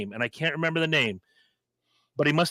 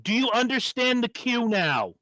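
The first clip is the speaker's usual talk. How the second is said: higher, pointed question; mock Gene lecture; 8 semitones higher than usual. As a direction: lecture a crew member pointedly. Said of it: "He goes".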